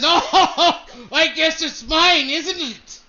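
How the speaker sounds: chuckling smug bidder; laughing. Acted. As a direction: laugh through the words. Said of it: "he laughs and he says".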